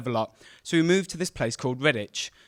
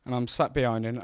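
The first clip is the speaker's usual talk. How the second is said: ordinary talk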